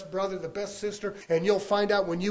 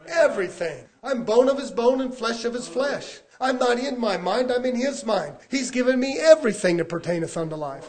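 high, exultant preacher declaration; emphatic preaching; 5 semitones higher, 5 dB louder than usual. Real, not acted. Preacher declaring what God gave him, emphatically. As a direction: proclaim it with raised pitch and conviction.

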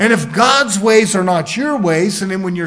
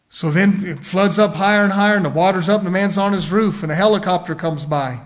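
usual speaking voice

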